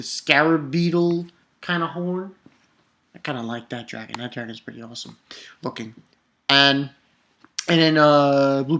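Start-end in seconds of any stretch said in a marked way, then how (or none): 3.1-5.9 much softer, almost whispered; a hushed side comment to the viewers; whispered aside. Real, not acted. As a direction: lean in and confide it. Quiet aside about the dragon.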